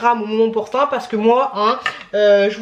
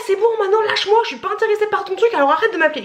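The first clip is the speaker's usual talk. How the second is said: high-pitched mock outburst at a caller; imitating fed-up friend; 9 semitones higher, faster than usual. Friend grabs the phone from her to stop the calls, mock angry. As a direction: act out a friend telling off the telemarketer. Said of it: "Il m'a dit, ouais".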